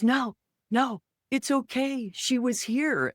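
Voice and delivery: high, moved voice of a weeping father; tearful dad. Retold or imitated. Dad, in tears after the psychic call, high and moved.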